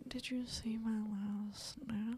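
slow whispered question. Retold or imitated. Retelling her unanswered snap, slow whisper. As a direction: hush it sheepishly.

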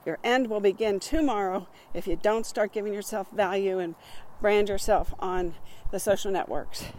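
normal talking voice

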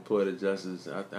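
ordinary talk

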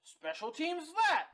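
mocking, high-pitched parroting; drawn-out singsong mimicry of a boastful coach; Urban Meyer. Host mocking the coach's talk of special teams, high and drawn out. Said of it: "Urban Meyer was all".

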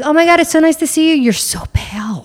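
high, fast, loud gushing; gushing Italian mom. Retold or imitated. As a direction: gush like an overbearing mom. Italian mom greeting, exaggerated.

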